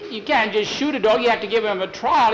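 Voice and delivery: put-on high, loud boyish pleading; boy's urgent argument. Boy demanding a trial for his dog, urgent high voice.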